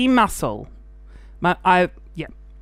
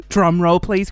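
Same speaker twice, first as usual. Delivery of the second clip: normal talking voice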